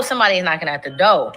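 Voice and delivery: normal talking voice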